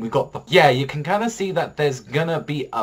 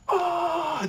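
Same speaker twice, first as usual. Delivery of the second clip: a sudden shrill cry of a crowd, much higher; mock team scream. Fan imitating the team at the final shot, high mock scream. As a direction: imitate the whole team shrieking at the buzzer. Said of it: "like, just screaming".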